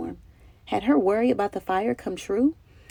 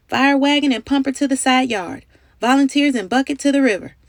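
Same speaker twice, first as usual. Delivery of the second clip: louder, quickening commands; shouted orders; 5 semitones higher, 4 dB louder than usual. A narrator's reading. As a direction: direct the crew fast at the fire. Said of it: "cried the captain through his ten speaker trumpet".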